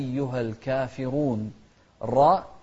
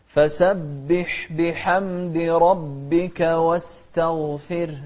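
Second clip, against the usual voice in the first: slow melodic tajweed chant; Quran recitation; 2 semitones higher, 5 dB louder, much slower than usual. Shown as a demonstration. Teacher reciting Quran to students, slow melodic chant.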